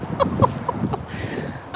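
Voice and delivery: high breathless nervous laugh; shocked laugh. Real, not acted. After near fall.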